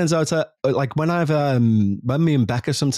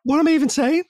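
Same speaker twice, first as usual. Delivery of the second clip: high-pitched puzzled self-questioning; mock bafflement; faster than usual. Speaker voicing confusion over a repeated word, mock bafflement. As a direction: squeak it out, baffled at your own words. Acting out losing a word's meaning.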